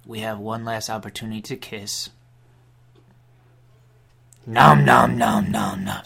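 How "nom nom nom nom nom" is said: loud chomping chant; snapping teeth. Acted. Leads the nom chant.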